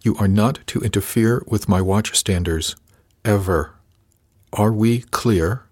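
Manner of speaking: each word separate and deliberate; slow, emphatic warning; biting off each word. A narrator's reading. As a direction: bite off the words, slowing at the end. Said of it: "He bit off each word".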